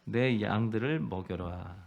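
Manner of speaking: low, soft, slow charge; gentle command. Acted. Jesus gently commissioning Peter, soft and slow. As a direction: tell him tenderly to feed the sheep.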